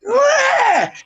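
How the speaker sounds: high shrieked what; shocked shriek. Retold or imitated. Mom reacting to her son's reason, shrieking. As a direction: shriek it in disbelief.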